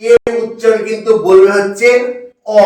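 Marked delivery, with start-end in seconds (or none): 2.6-2.6 "অ" alone after a pause; correct pronunciation. Shown as a demonstration. Teacher having students listen to the right sound, "অ" set apart. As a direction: sound out "অ" as one isolated vowel. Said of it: "এবার সঠিক উচ্চারণটা শুনে রাখো".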